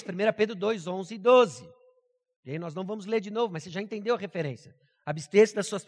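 normal talking voice

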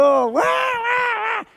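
very high, stretched-out, shouted newborn wail; drawn-out imitated baby wail, loud. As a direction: wail it out slow like a newborn's first cry. Imitating newborn baby crying again.

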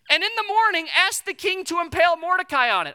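high, loud mock scheming wife. Wife urging Haman, in a high loud mock voice. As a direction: ham up the wife's brilliant idea. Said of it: "She's equally intelligent".

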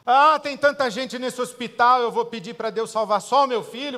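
preacher voicing a guilty parent's doubt, loud; mock objection. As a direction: throw out the objection loudly. Mocking objection, hospital.

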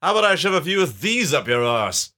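hammed-up comic villain taunt; bad man. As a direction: threaten with big swooping emphasis. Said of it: "says the bad man".

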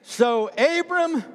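high, slow, loud call; slow loud preacher's chant; preached read-along. Shown as a demonstration. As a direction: lead the church in reading it aloud. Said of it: "say it again".

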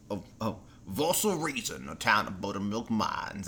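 slow, low, drawn-out impression; hammed-up slow actor's drawl; Jeremy Irons. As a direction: imitate an actor intoning a movie line, unhurried. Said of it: "Jeremy Irons is like".

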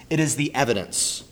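ordinary talk

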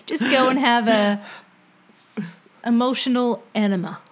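hamming up an American coach's voice; American accent. Retold or imitated. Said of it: "That was my American accent".

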